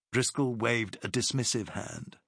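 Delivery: usual speaking voice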